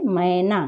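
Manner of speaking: मैना with the ai glide, /məena/; correct ai vowel. Shown as a demonstration. Teacher repeating the correct मैना for pupils. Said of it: "मैना नहीं होता है".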